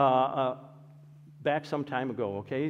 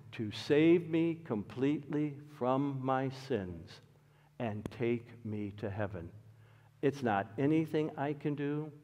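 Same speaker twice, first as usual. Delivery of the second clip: quiet prayer; 4 semitones lower, 8 dB quieter than usual. Pretend. Voicing a believer's prayer.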